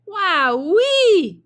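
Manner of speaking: high, loud wowee; excited exclaim. Acted. Jack sees the giant beanstalk, a high, loud wowee. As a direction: burst out in wonder. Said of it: "exclaimed Jack".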